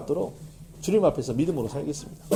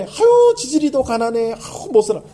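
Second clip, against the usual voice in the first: mocking sigh; 7 semitones higher, 5 dB louder than usual. Mocking remark quoted in sermon.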